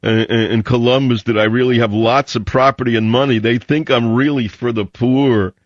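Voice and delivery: mocking, high-pitched caricature of a fake idealist; phony do-gooder act. Host lampooning a rich bookstore owner playing communist, mocking put-on voice. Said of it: "who puts on a big act".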